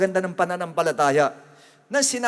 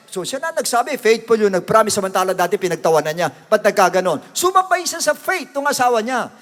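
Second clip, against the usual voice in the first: ordinary talk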